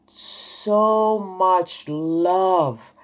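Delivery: savoring an immense love, word by word; overwhelmed with love. Real, not acted. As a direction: stretch each word, flooded with feeling. Reliving overwhelming love in dream.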